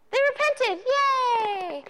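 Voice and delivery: high, bright mock celebration; mock cheer. Preacher cheering the happy ending, mock excited. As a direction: cheer it like a kid's story ending.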